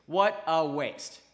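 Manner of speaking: a sermon's solemn judgment on the loss; slow, weighty verdict. Real, not acted. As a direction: let each word land heavily. Repeats the verdict, drawn out.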